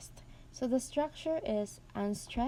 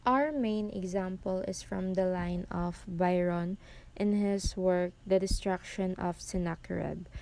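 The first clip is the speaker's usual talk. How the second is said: ordinary delivery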